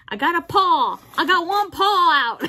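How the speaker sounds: excited shout at pulling a paw out. Real, not acted. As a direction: cheer as the paw comes free. Excited shout at cat toy.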